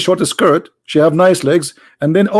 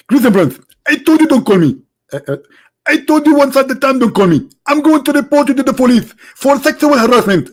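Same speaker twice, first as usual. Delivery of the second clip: much higher, indignant preacher voice; Zakir Naik impression; 8 semitones higher than usual. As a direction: play the annoyed preacher being called. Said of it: "We can call Doctor Zakir Naik".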